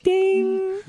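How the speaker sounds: high ding. Pretend. Mimicking a bell ding.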